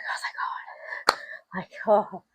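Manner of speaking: slow, breath-only sigh of relief; relieved whisper. Retold or imitated. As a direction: whisper it like a huge weight lifted. Retells own relief at dog escaping.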